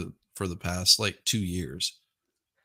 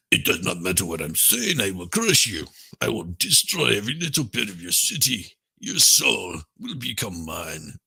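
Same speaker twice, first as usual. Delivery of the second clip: barbarian; 5 dB louder than usual. Retold or imitated. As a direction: growl it like a barbarian warlord. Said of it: "you know what it looks like he's saying?".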